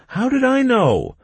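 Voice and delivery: high, loud defensive cry; defensive outburst. A narrator's reading. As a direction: protest it loudly. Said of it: "I tried to defend myself".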